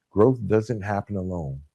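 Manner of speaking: ordinary talk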